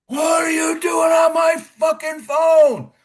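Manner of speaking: high, slow, furious yell from a distance; retold drill sergeant screaming from a mile away; furious shout. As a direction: bellow it across the yard like a drill sergeant. Drill sergeant yelling from afar.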